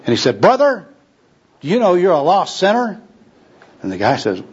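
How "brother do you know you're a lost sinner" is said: high-pitched, eager challenge to a sinner; enthusiastic evangelist. Acted. Gospel worker accosts a tramp on a corner, enthusiastically. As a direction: pounce with a zealous, raised-pitch opening. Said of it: "advances upon this tramp with obvious enthusiasm".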